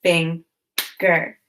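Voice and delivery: "Finger" split into two syllables with a long pause between; "Finger" broken as fing... ger; chopped syllables. Shown as a demonstration. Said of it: "Now chop the syllables in finger".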